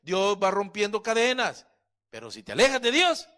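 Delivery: full-force preaching. Acted. Pastor thundering at his church. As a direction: preach it out to the congregation.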